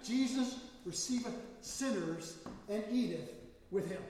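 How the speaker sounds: loud, high preaching of the verse; King James proclamation. Retold or imitated. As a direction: proclaim it like scripture from the pulpit. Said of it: "right out of King".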